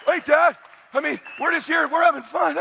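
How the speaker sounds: put-on high-pitched pleading little boy; startled kid. Son protesting to his suddenly intense dad, alarmed.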